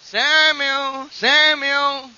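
high, loud, stretched-out call; audible calling. Acted. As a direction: call out the name to wake a sleeper. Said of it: "here he heard God audibly calling him".

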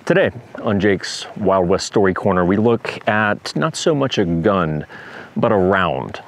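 slow, loud showman announcing; show host intro. Acted. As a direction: present the segment like a host. Host opens his story corner segment.

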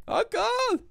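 high shouted cartoon reply; cartoon quote shout. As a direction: yell like Jade's uncle. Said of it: "Jackie Chan Adventures".

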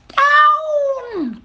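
very high, loud witch wail; high-pitched drawn-out call; witch's cry. A narrator's reading. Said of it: "cried the witch".

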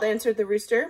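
ordinary talk

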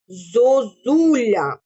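slow, syllables stretched; slow dictation. Shown as a demonstration. Teacher dictating to first graders. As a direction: draw out «Зозуля» zo-zu-lia. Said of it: "протягуючи кожний склад".

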